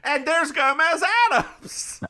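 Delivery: excited. Acted. Excited announcing a favourite actor.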